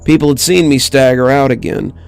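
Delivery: ordinary delivery, nothing marked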